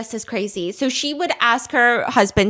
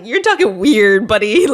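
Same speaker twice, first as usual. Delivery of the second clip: mock baffled; 4 semitones lower, 6 dB louder than usual. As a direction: push back on her strange tone. Husband reacting to odd voice.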